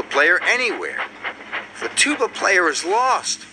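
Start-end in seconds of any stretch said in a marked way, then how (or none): 1.8-3.5 high, mildly woeful moan; little engine lamenting, pitched up. A narrator's reading. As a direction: moan it in a higher character voice. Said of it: "moaned Thomas".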